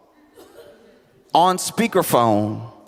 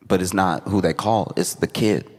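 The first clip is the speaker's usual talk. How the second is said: ordinary talk, nothing marked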